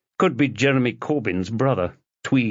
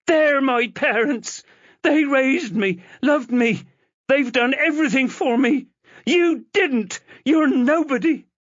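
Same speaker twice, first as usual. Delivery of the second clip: put-on girl defending the parents who raised her; furious rant; 3 dB louder than usual. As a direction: hammer each line at him. Chloe defends her adoptive parents.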